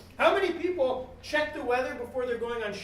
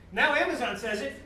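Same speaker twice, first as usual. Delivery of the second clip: normal talking voice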